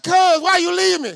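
high, loud, incredulous complaint; indignant call. Retold or imitated. Preacher scolding cousin for running off, loud indignant. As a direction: demand to know why he was left behind.

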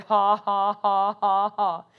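low, deliberate fake "ha ha"; put-on laugh. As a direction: force out an evenly spaced laugh. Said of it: "I put that laugh on".

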